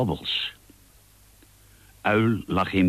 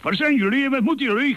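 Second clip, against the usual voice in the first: razendsnel, heel hoog gegil; gillend; 3 dB louder, much faster than usual. A narrator's reading. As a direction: gil het in één adem, hoog en snel. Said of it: "gilde hij". (schel hoog gillen)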